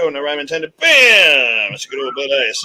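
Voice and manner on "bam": punchy shouted bam; loud exclamation. Real, not acted. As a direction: belt it out like a catchphrase. Toasting exclamation at the camera.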